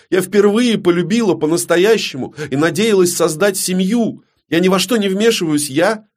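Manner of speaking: high, urgent, insistent protest; desperate pleading. Acted. Далина protests her innocence to the queen, desperately pleading. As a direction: plead your innocence, pitched right up.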